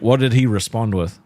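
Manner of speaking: normal talking voice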